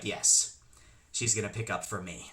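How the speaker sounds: very low, hushed mom voice; confident mom. Retold or imitated. Mom putting on her glasses to take over, low confident voice. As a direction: drop it low and quiet, sure of it.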